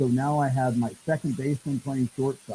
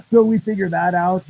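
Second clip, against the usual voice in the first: laughing; 7 semitones higher, 10 dB louder than usual. Real, not acted. Laughing after the joke.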